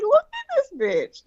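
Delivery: high, slow mock scoff; drawn-out amused disbelief at the video. Showing the Publix cashier the video, scoffing and high.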